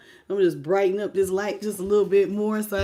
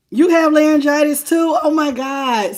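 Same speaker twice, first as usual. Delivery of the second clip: louder, higher surprised question; 4 semitones higher, 6 dB louder than usual. Real, not acted. Reacting to a viewer who also has laryngitis, surprised and louder. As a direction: react in disbelief that they're sick too.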